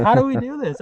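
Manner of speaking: high, loud confused cry; puzzled outburst; bewildered. Retold or imitated. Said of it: "So we were like".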